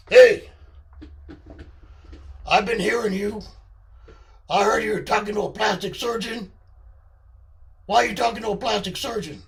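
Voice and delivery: loud, confrontational character voice; loud accusing menace; Charlie's menacing voice. Acted. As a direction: demand answers in a menacing put-on voice. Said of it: "do the deeper voice".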